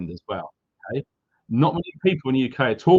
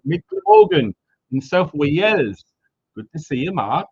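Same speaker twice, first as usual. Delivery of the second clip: bad Welsh accent; 4 semitones higher, 5 dB louder than usual. Streamer greeting a viewer from South Wales in a bad Welsh accent. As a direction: have a clumsy go at a Welsh accent. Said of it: "my Welsh accent sucks".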